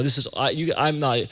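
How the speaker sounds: normal talking voice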